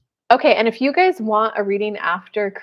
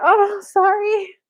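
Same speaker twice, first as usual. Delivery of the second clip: mock whimper; 11 semitones higher, 6 dB louder, much slower than usual. Nervous Kelly voiced in story.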